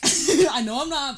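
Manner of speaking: laughing. Real, not acted. Laughing about feeling weak.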